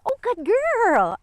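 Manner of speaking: high, drawn-out cooing; delighted dog praise. Real, not acted. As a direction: coo long and high to reward the dog.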